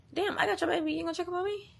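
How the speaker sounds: high-pitched wounded appeal; imitated pleading. Pregnant woman asking her ex for attention, high pleading imitation. Voices her past plea to him.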